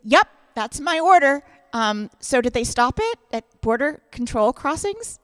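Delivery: loud breezy mock delight; cheery play-along. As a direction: chirp it like a happy customer. Cheerfully playing along with scammer.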